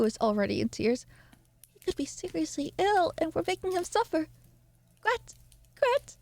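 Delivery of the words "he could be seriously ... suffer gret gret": high, rising panicked call, last name shouted; an anxious mother calling in panic; frantic mother's cry. Acted. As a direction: cry out for the daughter in rising alarm. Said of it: "she then cried".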